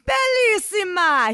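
high, loud, drawn-out Italian cry; ecstatic, stretched shriek. A narrator's reading. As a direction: shriek it out slowly in delight. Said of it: "he shrieked".

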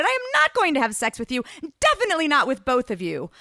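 loud, high, indignant refusal; shouting. A narrator's reading. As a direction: shout it to lay down the law. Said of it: "I shouted".

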